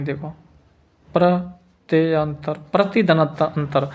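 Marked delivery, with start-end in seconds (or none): 1.1-2.5 प्रत्यंतर drawn out slowly, syllable by syllable; dictating प्रत्यंतर at half speed; slow dictation. Shown as a demonstration. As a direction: spell प्रत्यंतर aloud slowly for the class to write. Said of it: "प्रत्यंतर ऐसे लिखेंगे".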